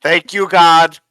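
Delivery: loud, high burst of relief; relieved outburst. Real, not acted. Recapping and finally recalling the name, a loud relieved burst. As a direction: shout the name as it finally comes back.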